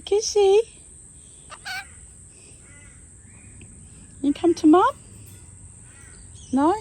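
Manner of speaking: high, loud, fast cooing; baby talk. Acted. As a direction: coo at the pet bird. Cooing to pet bird.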